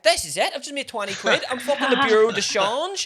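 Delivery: high-pitched triumphant crowing; gleeful bragging. Retold or imitated. Waiter who just made twenty quid exchanging notes, gleeful bragging. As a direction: build into loud self-congratulation. Acts out delight at profit.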